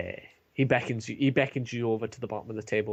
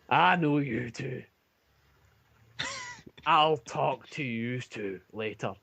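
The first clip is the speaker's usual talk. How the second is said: slow, deliberate warning; a lightly voiced commander, slowly promising trouble; a hint of a stern commander; 3 semitones higher, slower than usual. Ulfric to his guards, a slow lightly voiced warning.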